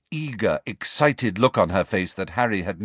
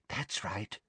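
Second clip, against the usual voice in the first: very soft villainous encouragement; quiet, greedy approval; hushed coaxing; 14 dB quieter than usual. A narrator's reading. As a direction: murmur it to draw her out. Umbridge coaxing the sobbing girl.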